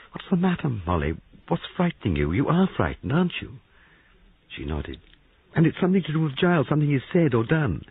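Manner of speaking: a hint of a nervous young man. A narrator's reading. Young man pressing, higher voice.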